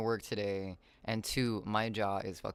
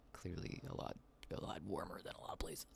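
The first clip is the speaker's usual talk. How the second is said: whisper; 5 semitones lower, 11 dB quieter than usual. Real, not acted.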